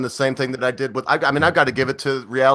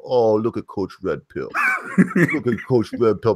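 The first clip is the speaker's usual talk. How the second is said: mock giant marvelling; slower than usual. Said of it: "hold Coach Red Pill in his hand like this and go".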